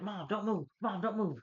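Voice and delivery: high-pitched retold warning; hammed-up alarm; mock alarm. Retold warning about the squirrel.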